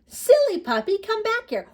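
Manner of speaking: high, loud playful scolding; little girl calling. Acted. Little girl calling her puppy back, high playful scold. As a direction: call after a naughty puppy.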